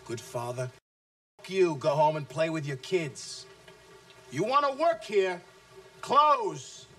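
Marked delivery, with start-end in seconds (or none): 4.3-6.9 higher, louder barked command; furious sales boss snapping "close"; angry order. Acted. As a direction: bark the order at them.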